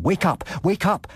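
high urgent call; urgent cry. A narrator's reading. Narrator pounding the partition to wake the family, high and urgent. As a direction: shout them awake through the wall. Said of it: "I cried".

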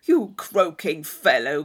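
put-on loud, slow, hearty young man; Thorpe's blustering cry. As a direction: boom it out at him teasingly. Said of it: "cried Thorpe".

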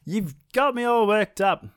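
hammed-up furious, pitched high; a trapped god fuming; angry sun. The snared sun fuming at Maui, hammed-up and high. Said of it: "I'm really angry now".

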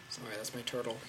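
ordinary delivery

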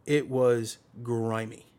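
ordinary delivery

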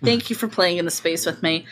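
ordinary talk

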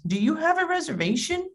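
politely acted question rising high; maitre d'. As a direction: imitate a prim restaurant host. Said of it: "maitre d' says".

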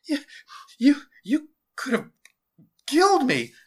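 high, loud, halting stammer; gulping fright. A narrator's reading. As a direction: gasp it out in shock, word by word. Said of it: "gulped Jason".

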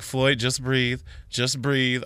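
soft reassuring coax; calming boss. Retold or imitated. Boss calming Floyd during the rush, soft reassuring tone. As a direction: gently soothe a stressed worker. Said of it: "my boss was like".

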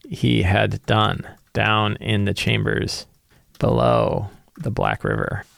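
slow, hushed, ominous secret read aloud; slow dramatic reading. Acted. GM reading a secret to his notes, slow and ominous. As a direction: let each phrase hang, slowly.

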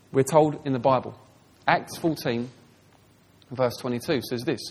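ordinary talk

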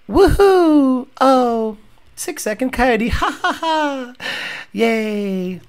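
feeble, drawn-out little celebration; mock a cheer with no energy behind it; half-hearted cheer. Host mocks racer's response to six-second Coyote, unenthusiastic. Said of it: "And this is what happens".

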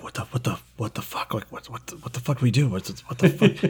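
soft, panicky whispering; hushed whisper. Acted. Game master as crew overheard on microphone, hushed panicked whispers. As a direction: whisper it frantically, tripping over repeats. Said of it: "You hear whispering".

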